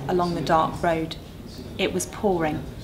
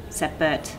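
ordinary delivery, nothing marked

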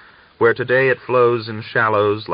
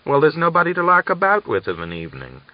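Daria complaining; 9 semitones higher, 5 dB louder, faster than usual. A narrator's reading. Daria answers, still complaining.

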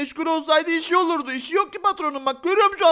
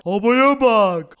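deep slow voice; 5 semitones lower, 6 dB louder, much slower than usual. Acted. Tarık voiced in role-play.

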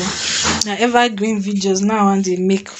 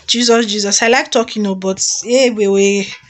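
normal talking voice

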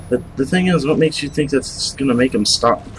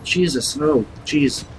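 ordinary talk, nothing marked

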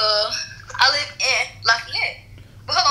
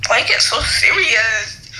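mock shout; 4 semitones lower, 4 dB louder than usual. Real, not acted. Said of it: "This is scary".

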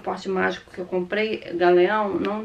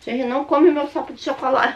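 calling out; 5 semitones higher, 7 dB louder than usual. Real, not acted. Calling out to someone nearby.

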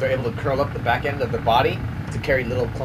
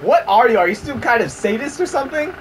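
mock outrage; 8 semitones higher than usual. Real, not acted. Mock outrage at game character.

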